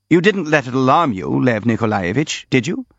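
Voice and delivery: ordinary delivery, nothing marked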